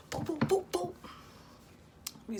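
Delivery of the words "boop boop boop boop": mock fight sounds. Imitating the fight starting.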